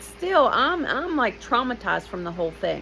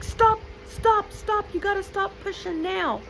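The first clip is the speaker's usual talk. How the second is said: urgent doctor; 13 semitones higher than usual. Retold or imitated. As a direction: cut her off fast, alarmed. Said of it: "the doctor's like".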